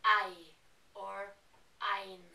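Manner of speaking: ei and ein with an ai sound; western ei or ein. Shown as a demonstration. A Norwegian showing the western dialect, ei or ein with ay. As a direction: say ei or ein as ay, ayn. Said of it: "they would say".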